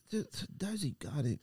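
normal talking voice

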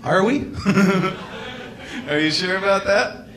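high, laughing disbelief; laughing mock doubt. Comic laughing at his own manly claim, high and amused.

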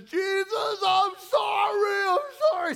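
high, loud, drawn-out wail; screaming repentance. Retold or imitated. Elderly woman on her knees repenting, screaming wail. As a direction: cry out to Jesus, stretching it long. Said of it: "I see her screaming out in pain".

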